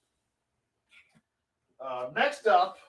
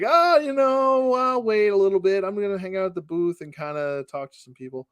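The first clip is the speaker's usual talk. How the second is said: casual brush-off; 7 semitones higher than usual. Retold or imitated. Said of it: "And I was like".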